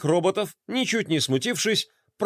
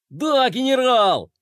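high, slow eager cry; Flay eagerly answers the general, crying out; excited cry; 8 semitones higher, 3 dB louder, slower than usual. A narrator's reading. Flay answers the general with an excited cry. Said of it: "вскричал Флай".

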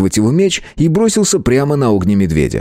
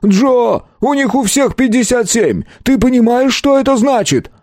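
panicked high yelling; hysterical shout; 12 semitones higher than usual. A narrator's reading. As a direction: scream it in sheer panic. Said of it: "истерично закричал".

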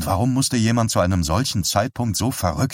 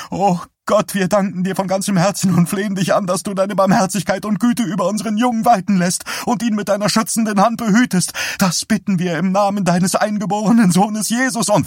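much higher, pleading, rushed; mother on her knees praying for her son, high and frantic; frantic high prayer; 13 semitones higher, 4 dB louder than usual. Acted. Vera prays on her knees.

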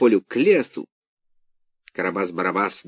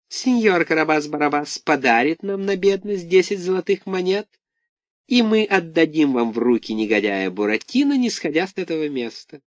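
high, sly fox voice; Alice the Fox; 6 semitones higher than usual. A narrator's reading. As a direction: wheedle the money out. Fox Alice bargains sweetly.

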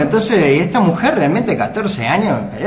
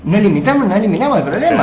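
raised, higher insistence; louder higher insistence; firm louder claim; 7 semitones higher, 5 dB louder, faster than usual. Real, not acted. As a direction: press the point home. Firm argument climax.

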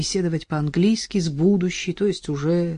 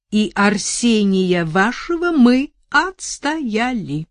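smug lawyer; 4 semitones higher, 4 dB louder than usual. Acted. Lawyer reports verdict.

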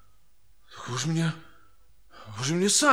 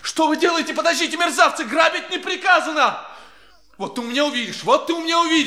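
angry shouting; 6 semitones higher, 3 dB louder than usual. Acted. Clerk robbed, shouting threats.

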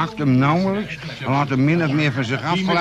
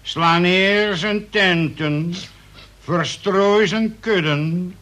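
preaching at full force; fiery prayer; 4 semitones higher than usual. Acted. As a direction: call down wrath like a preacher. Second prayer after tea.

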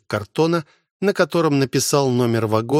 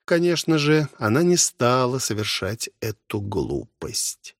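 ordinary delivery, nothing marked

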